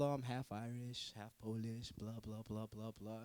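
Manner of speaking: a lightly voiced bored classmate rattling on; mock classmate.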